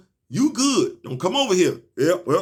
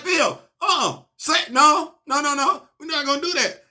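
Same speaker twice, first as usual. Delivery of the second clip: high mock refusal; 9 semitones higher than usual. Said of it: "You're like".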